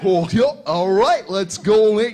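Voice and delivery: mock cartoon-goof voice; hammed-up dopey cartoon imitation; Goofy. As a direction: put on a goofy cartoon character. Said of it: "I learned it from Goofy".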